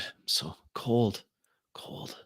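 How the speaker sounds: weak, fading whisper; shivering, cold. Acted. Wounded woman lying on the ship floor, weak shivering whisper. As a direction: whisper faintly as if freezing and slipping away. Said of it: "She starts shivering".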